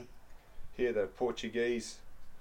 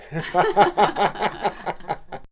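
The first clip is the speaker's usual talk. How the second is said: a burst of real, high laughter; loud high laugh; 8 semitones higher, 8 dB louder than usual. Real, not acted. Daughter laughing hard while telling the interviewer about Leo, loud high laugh.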